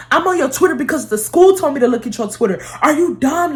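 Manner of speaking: high-pitched, imitated scolding; mock an exasperated parent laying into a kid; going off. Mom scolding daughter over her Twitter, going off. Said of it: "she was going off on me".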